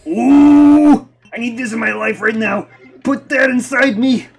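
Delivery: high, loud excited yell; loud, high excitement; excited shout. Real, not acted.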